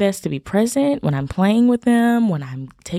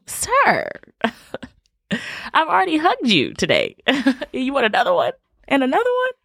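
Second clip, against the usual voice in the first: much higher, laughing mock complaint; laughing mom pretending to be fed up; mock exasperated mom; 8 semitones higher than usual. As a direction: playfully scold the hug-hungry son. Mom teasing son wanting more hugs.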